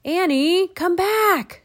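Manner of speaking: high, loud call back; urgent shout. A narrator's reading. Jack shouts after Annie running down the hill, urgently. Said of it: "Jack shouted".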